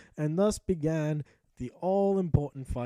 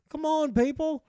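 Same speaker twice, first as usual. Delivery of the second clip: exasperated; 9 semitones higher, 6 dB louder than usual. Real, not acted. Exasperated at missing summon signs.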